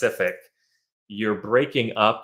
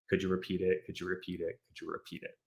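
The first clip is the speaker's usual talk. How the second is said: each repeat softer until a whispered end; fading repetition; 10 dB quieter, faster than usual. Shown as a demonstration. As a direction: trail off, bored of repeating. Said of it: "you don't always have to say".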